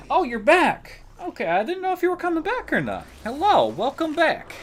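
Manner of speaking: high, loud, delighted greeting; light up as a friend comes back; bright rising happy welcome back; excited welcome. Real, not acted.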